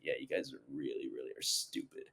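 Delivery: low, quiet mock villain sneer, last words breathed out; a hint of a smug supervillain looking down on them; deep villain taunt. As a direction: sink the voice low and sneer it softly. Imitating an unkillable villain mocking.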